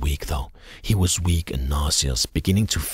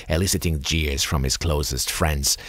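ordinary delivery

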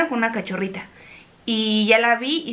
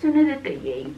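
tender; 3 semitones higher, 4 dB quieter than usual. Real, not acted. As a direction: coo lovingly to the dog. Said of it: "un momento de besos".

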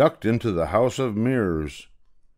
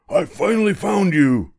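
high, louder triumphant grab; angry ticket seller; 10 semitones higher, 4 dB louder than usual. Acted. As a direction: finally caught the monkey. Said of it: "said the angry ticket seller".

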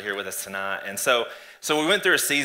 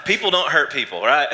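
louder, higher mock innocence; 4 semitones higher, 8 dB louder than usual. Guest jokes to host about church hurt, mock innocence. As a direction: insist on it with feigned conviction.

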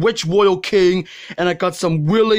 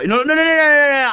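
shouting no; 7 semitones higher, 3 dB louder, faster than usual. Real, not acted. Rejects take loudly.

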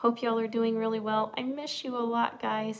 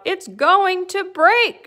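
high, loud, drawn-out warning; acted storybook wail of alarm; alarmed cry; 10 semitones higher, 14 dB louder, much slower than usual. A narrator's reading. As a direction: cry out as the panicking cow. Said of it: "she cried".

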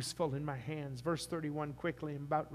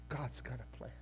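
quieter, lower, settled; softer repeat; 3 semitones lower, 5 dB quieter than usual. Real, not acted. Pastor reassuring the congregation quietly after a laugh. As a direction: say it gently to yourself.

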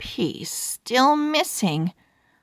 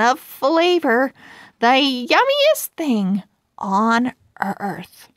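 higher, showy sales-announcer voice; salesman pitch; 5 semitones higher than usual. Acted. Pug in his special outfit pitches peanut butter to Nuts, salesman voice. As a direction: sell it like a TV infomercial host.

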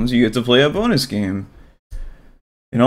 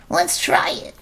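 high, softer, timid little creature voice; nervous squeaky creature; 8 semitones higher, 4 dB quieter, slower than usual. Acted. Blue creature introduces itself to Bubble, high and timid.

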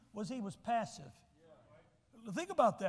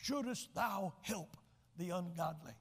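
lightly voiced old preacher, softer; 3 semitones lower, 4 dB quieter than usual. Remembering Doctor Malone preaching this text, softer. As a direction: echo Doctor Malone's delivery. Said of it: "I can see him with his hands".

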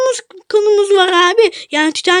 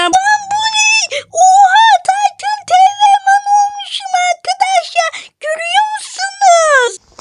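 high, crying shout of shock and wonder; 8 semitones higher than usual. Acted. Boy shrieking to friends about his transformed body, high and shocked. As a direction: yell it high, half crying in disbelief.

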